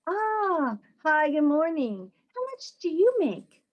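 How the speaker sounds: chirpy stranger with a prying question; nosy stranger. Retold or imitated. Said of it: "That's not acceptable".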